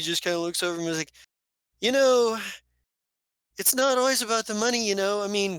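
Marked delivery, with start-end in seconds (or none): 1.8-5.6 high, louder pleading voice; placating rogue. Acted. Ducey pleading with his companion over money, high placating voice. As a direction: talk fast and high to smooth things over.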